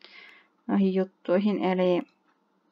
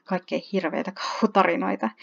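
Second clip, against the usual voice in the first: ordinary delivery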